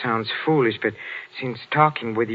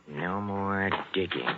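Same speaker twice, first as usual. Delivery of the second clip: low, slow, sulky repeat under the breath; grumbling echo of his wife's command; quiet mocking echo; 4 semitones lower, 8 dB quieter than usual. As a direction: mutter her order back to yourself. Muttering back wife's order alone.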